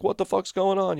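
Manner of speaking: high-pitched mock panic; imitating alarmed girls in a high voice; imitated screaming girls. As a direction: pitch it way up without yelling. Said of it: "The girls are screaming".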